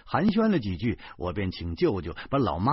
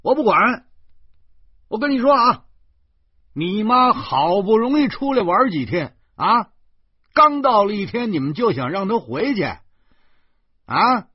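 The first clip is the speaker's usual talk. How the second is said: high, very loud enraged shouting; furious yelling; 11 semitones higher, 10 dB louder than usual. A narrator's reading. Uncle yelling at nephew over the phone, furious shout. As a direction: blow up and yell down the phone. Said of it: "舅舅竟然勃然大怒，他在电话里嚷嚷着说".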